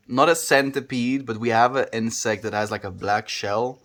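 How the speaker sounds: usual speaking voice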